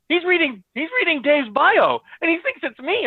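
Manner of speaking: high-pitched retold disbelief; alarmed realization. Guest realizes interviewer is reading Dave's bio, alarmed.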